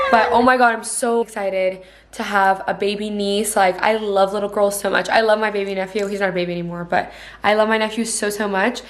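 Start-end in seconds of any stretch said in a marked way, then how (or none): none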